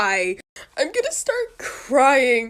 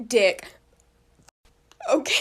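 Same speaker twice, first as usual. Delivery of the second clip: usual speaking voice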